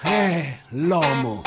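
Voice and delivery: ordinary delivery, nothing marked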